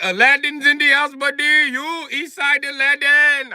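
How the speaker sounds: high, loud gleeful mock accent; mock Indian accent. Clerk greets his shaved friend, gleeful mock accent. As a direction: announce it like a delighted friend. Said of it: "He said".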